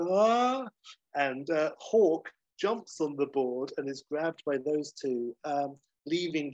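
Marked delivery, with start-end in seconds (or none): 0.1-0.7 short high mock yell of surprise. Retelling the lava surf, a mock heroes' yell. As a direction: yelp it like a cartoon hero.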